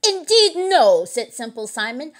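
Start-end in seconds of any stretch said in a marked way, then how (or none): 0.0-1.0 high, loud protest; indignant denial. A narrator's reading. Simple Simon answering the accusation, high indignant denial. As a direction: deny it emphatically.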